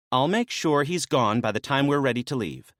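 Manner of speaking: lightly voiced woman, brisk and businesslike; a hint of a maenad laying out the plan; briefing maenad. Naya briefing Dionysus on the rescue plan, lightly voiced.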